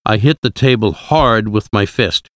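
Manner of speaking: ordinary delivery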